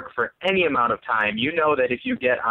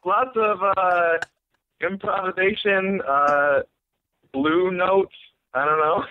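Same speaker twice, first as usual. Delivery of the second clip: halting, higher-pitched groping for terms; half-assed explaining; 5 semitones higher, slower than usual. Retold or imitated. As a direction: imitate bluffing through an answer with long gaps. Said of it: "half-assed, like, tried to explain".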